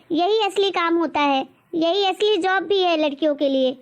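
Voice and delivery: normal talking voice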